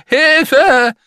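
very high, drawn-out scream; cry for help. A narrator's reading. Falling man calls for rescue, high screamed cry. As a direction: yell desperately for help at full force. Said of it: "rief der Mann".

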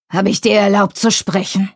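stern growled rebuke; growling. A narrator's reading. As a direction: growl it like a scolding father. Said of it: "knurrte er".

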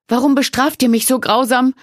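high, loud cry of pain; crying out under the whip; under torture. A narrator's reading. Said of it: "rief sie, während die Rute erneut ihren Rücken traf".

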